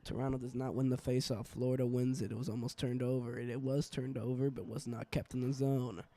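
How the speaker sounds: live hockey play-by-play; play-by-play commentary. Acted. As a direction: call the game as it happens.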